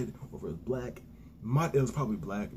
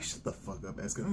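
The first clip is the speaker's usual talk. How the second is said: whispered put-down; whispered comeback at the screen; hissed retort; 2 semitones lower, 7 dB quieter than usual. Retold or imitated. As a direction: hiss it back under your breath. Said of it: "I'm like".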